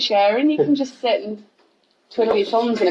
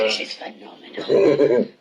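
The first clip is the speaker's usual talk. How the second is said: put-on slow, leering dirty old man; lightly voiced old lecher; 7 semitones lower, slower than usual. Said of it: "dirty old man voice".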